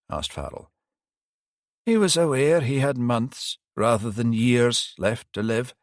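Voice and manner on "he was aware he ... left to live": put-on higher old priest voice, rueful; elderly priest. Priest admitting he failed the detective, higher pitched.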